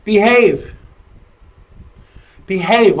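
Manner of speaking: ordinary talk, nothing marked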